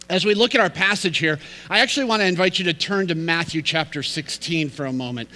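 ordinary delivery

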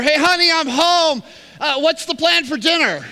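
high, loud call from across the house; hammed-up husband calling to his wife; husband calls from the front door to his wife, high and loud.

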